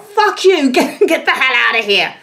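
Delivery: high, loud mock-furious brush-off; hammed-up angry rejection; mock outrage. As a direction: throw them out in a pretend rage. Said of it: "you're like".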